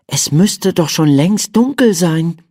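put-on high child's voice, wondering; puzzled little boy. A narrator's reading. Boy's puzzled thought, voiced in character.

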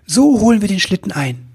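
enthusiastic. Acted. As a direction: cry out the sudden bright idea. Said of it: "rief er voller Begeisterung". (begeisterter hoher Ausruf)

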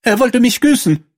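hoch gespielte Prinzessin, abweisend. Acted. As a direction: die Prinzessin deutlich höher, schnippisch. Prinzessin empört. (die Prinzessin will den Frosch loswerden, hoch und abweisend)

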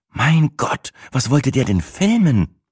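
horrified. A narrator's reading. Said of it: "fragte Sina entsetzt".

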